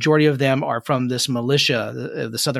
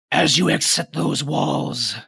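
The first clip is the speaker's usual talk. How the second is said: undead general. Acted. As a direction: proclaim terms like a menacing warlord. General answers the party in character.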